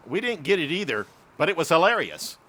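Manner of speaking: plain reading voice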